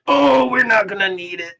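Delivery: high, loud cry of excitement; thrilled outburst. Real, not acted. Game master sees a natural twenty roll.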